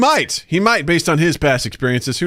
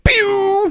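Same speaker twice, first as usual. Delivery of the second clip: high-pitched sound-effect "pew"; mimicked slip of speech as noise; imitated pew noise. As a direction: squeak out a quick laser "pew". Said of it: "So I went".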